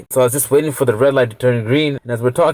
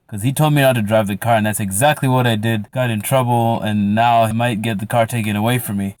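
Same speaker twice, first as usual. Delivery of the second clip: ordinary delivery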